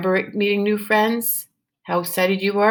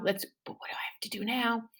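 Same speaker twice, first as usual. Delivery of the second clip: whisper; 3 semitones higher, 13 dB quieter, faster than usual. Shown as a demonstration. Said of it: "teacher whispers to class".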